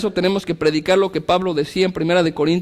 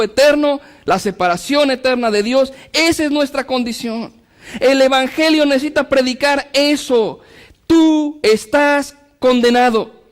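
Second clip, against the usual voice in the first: raised, driving sermon voice; fervent preaching; 6 semitones higher than usual. Acted. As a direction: preach it to the congregation. Preaching at full force.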